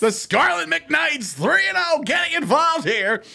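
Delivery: high, slow, shouted play-by-play call; hyped announcer call. Pretend. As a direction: belt it like a stadium announcer.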